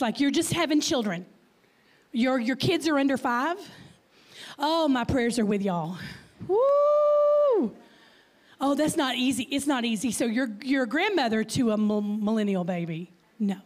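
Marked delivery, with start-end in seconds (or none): 6.5-7.7 long, high, loud "woo"; loud whoop. Real, not acted. Speaker cheering moms with kids under five, loud whoop. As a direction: let out a sustained high whoop.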